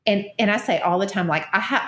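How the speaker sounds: usual speaking voice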